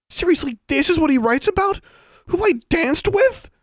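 very high, loud disbelief; outraged outburst. Acted. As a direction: exclaim in scandalized disbelief. Outraged reaction to newspaper gossip.

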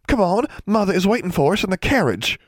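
put-on high, bubbly girlish urging; bright-eyed sister, voice pitched high; eager chivvying. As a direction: hurry them along excitedly. Julia hurries them along eagerly.

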